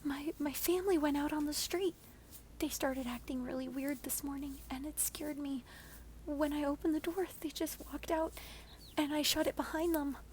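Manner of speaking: frightened neighbor explains what happened; anxious neighbor. Acted. Shelley explains her fear.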